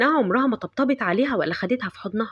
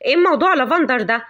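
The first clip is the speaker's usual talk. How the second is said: yelling mother; 3 semitones higher, 4 dB louder than usual. Acted. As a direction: shout down the daughter. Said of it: "فضلت تزعق وتقول". (أم بتزعق بصوت عالي)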